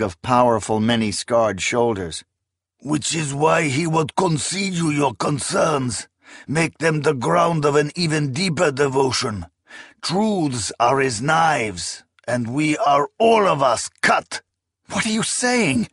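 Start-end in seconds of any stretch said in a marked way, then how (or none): 2.8-14.4 higher, measured heavy voice; warrior's reply. Acted. Skilvendi answers the protest. 14.9-15.9 high, alarmed question; startled question. Acted. Akamian's shocked question.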